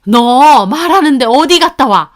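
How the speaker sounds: high, loud scolding outburst; angry mother-in-law. A narrator's reading. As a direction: demand an answer in outrage. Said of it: "어머님이 화부터 내시더군요". (버럭 화내는 시어머니)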